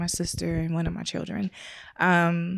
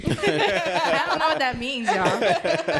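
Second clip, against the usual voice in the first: laughing as she speaks, higher and slow; 6 semitones higher, much slower than usual. Real, not acted. Wife laughs at her husband's mixed-up words, laughing. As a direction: chuckle it out, slowly.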